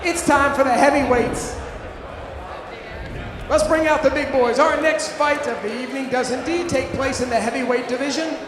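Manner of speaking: ordinary delivery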